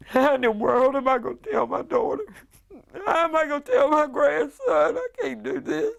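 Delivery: retold breakdown in tears; crying. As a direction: let the voice break with grief. Breaking down over telling family.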